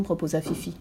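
usual speaking voice

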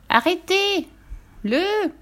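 very high, stretched-out pig cry; crying little pig. A narrator's reading. Little pig calls to stop the runaway, high cry. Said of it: "cria le petit cochon".

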